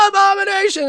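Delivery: high pitched crowd shout; imitating a crowd chant; crowd yell. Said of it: "everybody goes".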